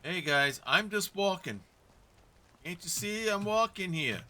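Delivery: put-on loud, brash New York accent. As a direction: play it as a New York street guy. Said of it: "That's my best, worst New York accent".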